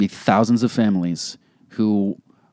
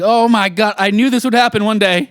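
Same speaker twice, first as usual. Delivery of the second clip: mock panic; 11 semitones higher, 3 dB louder than usual. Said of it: "I was like".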